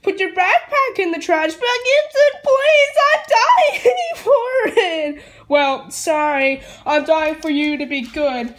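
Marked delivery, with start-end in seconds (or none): 1.5-5.2 high, wailing begging, slow; sobbing plea. Real, not acted. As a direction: wail the plea through tears. Child begs crying.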